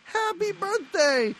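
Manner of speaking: mock party cheer. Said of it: "they're like".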